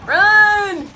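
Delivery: high, drawn-out shout; urgent yell. Real, not acted. Viewer calling out to the fighter, urgent. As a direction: yell it to send him running.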